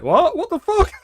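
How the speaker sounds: very high squeal of disbelief; hammed-up disbelief; shocked. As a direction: shriek it like total shock. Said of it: "It'd be just like".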